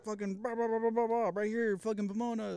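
high, held-back mock scream; squealed mock panic, rattling off an address; retold phone screaming. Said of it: "screaming into the phone".

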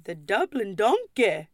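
hammed-up fake Irish accent, swooping on the drink's name; mock a customer's terrible Irish brogue; bad Irish accent. Obnoxious customer ordering a cocktail, exaggerated fake Irish accent. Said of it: "I can't even do a fucking Irish accent".

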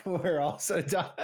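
laughing mid-sentence. Real, not acted. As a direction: try to talk through a laugh.

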